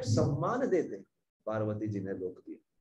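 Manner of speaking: ordinary talk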